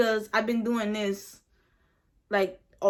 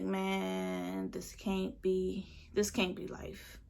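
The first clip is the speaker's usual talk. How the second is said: despairing mutter; 4 semitones lower, 11 dB quieter, much slower than usual. Retold or imitated. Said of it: "And I was just like".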